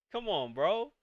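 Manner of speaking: normal talking voice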